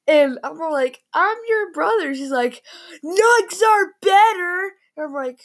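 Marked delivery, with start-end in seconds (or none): 1.1-2.1 much higher pleading squeak; mock plea. His own retold plea. 3.0-4.7 slow, high, put-on sister voice; mock sister.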